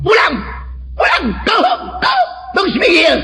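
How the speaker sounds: high-pitched angry shouting; enraged heckler. Retold or imitated. Dutchman interrupting a sermon in Amsterdam, enraged. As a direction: imitate a raging man yelling the preacher away. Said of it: "ada seorang Belanda ngamuk, marah-marah".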